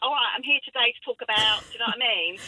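mock-present in a broad Cockney accent; southeast London accent. Voicing her old self interpreting for a deaf presenter, Cockney accent. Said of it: "southeast accent because I wanted to sound better".

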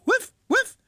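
high yappy woof woof like a small dog; dog barking. Retold or imitated. Radio host barks hello as Penny the dog. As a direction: bark it out like the family dog. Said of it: "it's Penny the dog".

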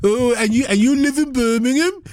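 put-on high, sing-song Brummie accent; teasing in a put-on Brummie lilt; mock Brummie. Teasing a friend who hates Brummie, in a Brummie accent. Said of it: "I hate the Brummie accent".

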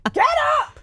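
mimicking a bossy order, high; parroting the bossy co-host; bossy imitation. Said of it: "she's a bossy one today".

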